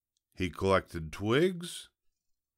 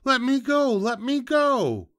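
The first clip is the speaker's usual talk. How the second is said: very high, loud squeal; squealing piglet; 8 dB louder than usual. A narrator's reading. Piglet kicking in the fox's grip, squealing high and loud. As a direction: squeal frantically to get free. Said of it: "The piglet kicked and squealed".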